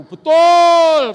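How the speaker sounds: high, very loud drawn-out yell; mock crowd shout; men's shouted agreement. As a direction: bellow a long "betul" like the men in the crowd. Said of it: "katanya gitu".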